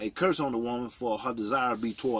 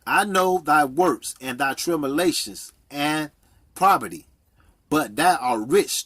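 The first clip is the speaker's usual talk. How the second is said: forceful, punched preacher's reading; scripture reading; 4 semitones higher, 6 dB louder than usual. Real, not acted. Preacher reading verse nine to listeners, forceful scripture reading. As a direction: proclaim the verse, hitting each key word. Reads Revelations aloud.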